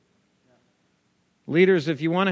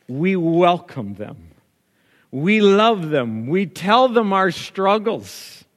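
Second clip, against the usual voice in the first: slow, louder preacher cadence; preaching build; 4 semitones higher, 8 dB louder, slower than usual. Acted. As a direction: lean into each phrase like a sermon.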